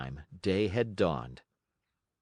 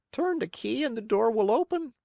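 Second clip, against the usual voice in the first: much higher tiny voice; a small hidden creature calling out; little voice; 13 semitones higher than usual. Acted. Same little voice.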